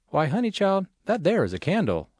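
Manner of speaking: Mary Lou's sweet southern drawl. A narrator's reading. Texas customer telling tech support what she sees, light southern drawl. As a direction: play a hint of a Texan's sweet drawl. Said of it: "in her sweet southern drawl".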